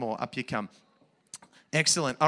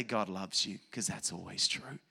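soft and lower, tender; gentle reassurance; 8 semitones lower, 6 dB quieter than usual. Real, not acted. Pastor telling the children God loves them, tender and soft. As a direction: say it softly like a blessing.

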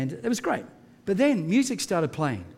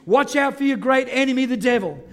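louder, higher sermon delivery; full-force preaching; 7 semitones higher, 5 dB louder than usual. Acted. Pastor preaching to the church about Joseph, full force. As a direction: preach it out to the congregation. Preaching urges alertness against the devil.

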